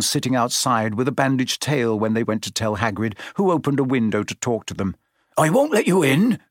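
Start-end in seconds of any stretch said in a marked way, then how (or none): 5.4-6.4 out of breath, higher; puffing. A narrator's reading. Hagrid at his window, puffing and refusing entry. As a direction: puff it out between breaths. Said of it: "he puffed".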